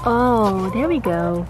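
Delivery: normal talking voice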